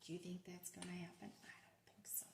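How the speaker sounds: hushed reassuring whisper. Real, not acted. Teacher whispering to the children, soft reassurance. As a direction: whisper it gently.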